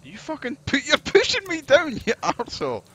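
high, loud frantic yelling; panicked yell. Real, not acted. Friend pushing him off rope.

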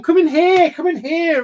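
loud, high call to another room. Real, not acted. Dad shouts for abusive son to come in, loud high call.

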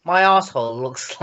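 ordinary delivery

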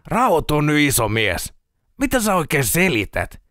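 lightly voiced incredulous friend, lively pitch swings; a touch of exasperated disbelief; sceptical friend. Friend telling a panicky man to calm down, mildly incredulous.

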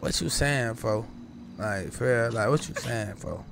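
low, soft cool-guy greeting; play it cool. Shown as a demonstration. Starting the threat off cool, low and soft. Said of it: "You can start off like".

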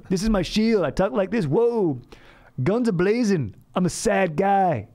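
high-pitched mock jolly guy; fun voice. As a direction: play a sad guy hiding behind a fun voice. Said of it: "you say it in a fun voice".

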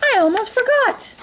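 very high fairy godmother voice; high-pitched exclamation of a sudden realization; startled cry. A narrator's reading. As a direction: pipe up high, caught out. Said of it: "cried the fairy godmother".

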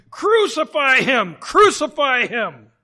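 loud, slow crowd shout. A narrator's reading. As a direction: bellow it like an angry mob. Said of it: "they cried out".

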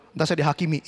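usual speaking voice

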